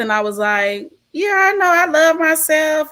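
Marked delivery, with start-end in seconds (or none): 1.1-2.9 offhand self-assurance. Retold or imitated. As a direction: imitate yourself brushing off a question, pitched up. Retold defensive reply to therapist.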